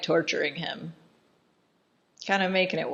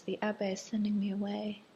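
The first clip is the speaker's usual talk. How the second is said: retold sadness; 4 semitones higher, 8 dB quieter than usual. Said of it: "she looks very sad".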